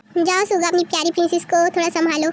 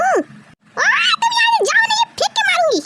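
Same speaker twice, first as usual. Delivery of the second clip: angry threat; 12 semitones higher than usual. Acted. Wife threatens to throw something.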